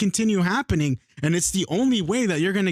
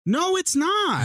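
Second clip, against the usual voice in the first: high, drawn-out emphatic denial; heated contradiction; 9 semitones higher, much slower than usual. Real, not acted. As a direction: contradict them hotly, pitch way up. Heated back-and-forth contradiction.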